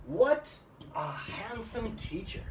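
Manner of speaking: exclaiming with a big high "What"; emphatic WHAT. Shown as a demonstration. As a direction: hit "What" hard, then glide on. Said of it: "another way to emphasize your speech".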